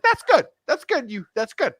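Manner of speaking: squeaky falsetto way up high; high-pitched Jerry. Retold or imitated. Podcaster mocking the team owner in a squeaky falsetto. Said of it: "I just wanted to do the high pitch".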